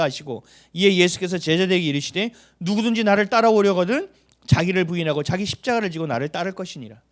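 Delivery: loud, solemn Bible reading; preacher reading the Gospel aloud; scripture reading. Acted. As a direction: declaim the verses from the pulpit.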